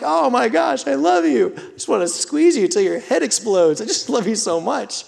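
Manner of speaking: high excited dad gushing over his son. Retold or imitated. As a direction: burst with love for your little boy. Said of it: "And I'm like".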